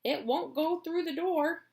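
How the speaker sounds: doubtful objection; Grandpa Joe. Acted. Grandpa Joe objects to Wonka, doubtful.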